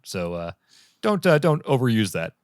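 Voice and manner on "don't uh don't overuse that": ordinary talk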